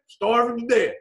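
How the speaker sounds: ordinary talk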